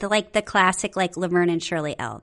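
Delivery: ordinary talk, nothing marked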